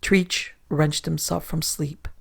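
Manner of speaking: usual speaking voice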